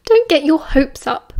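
amused, laughing tease. A narrator's reading. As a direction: laugh first, then say it with amusement. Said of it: "Lily laughed".

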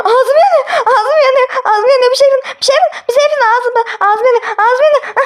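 high, frantic cries of mouth on fire; burning mouth panic. Acted. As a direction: squeal as if your mouth burns. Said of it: "Ne ağzın yanıyor?".

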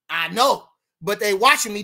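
ordinary delivery

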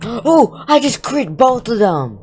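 gasp, then high-pitched excitement; excited outburst. Real, not acted. Gamer reporting a double critical hit, excited squeal. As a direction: burst out high after a gasp. Sudden lucky hit in game.

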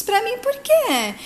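high, slow astonished question; surprised. Acted. Silvia to Caetano, surprised by the flowers, high and amazed. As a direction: ask it in delighted disbelief.

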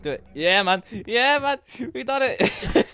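high triumphant shrieking; shrieking jackpot winner. Real, not acted. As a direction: scream with joy like a winner. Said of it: "why am I screaming so badly".

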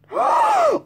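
sudden high gasp of surprise; sharp high gasp. Real, not acted. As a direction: gasp in shock. Opening surprise card from friend.

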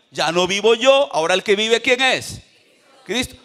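normal talking voice

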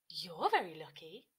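light ladybird character voice; chirpy ladybird greeting a caterpillar. Acted.

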